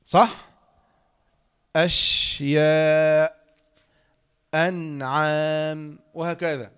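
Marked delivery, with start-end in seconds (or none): 1.8-3.5 أشياء drawn out very slowly, lingering on the still شـ; resting on the sukun. Shown as a demonstration. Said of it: "واسترحت كده". 4.5-6.0 أنعام drawn out very slowly, lingering on the still نـ; resting on the sukun. Shown as a demonstration. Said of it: "واسترحت كده".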